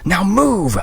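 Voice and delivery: high, urgent order; urgent shout. Acted. Christy ordering Graham out of the wrecked truck, urgent. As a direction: push him out of the truck with your voice.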